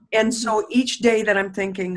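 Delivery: normal talking voice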